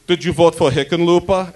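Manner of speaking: put-on a light German accent for an old nosy neighbour; nosy German neighbour pressing with questions; a light German accent, nosy and prying; German old lady accent. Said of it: "my Liesel voice".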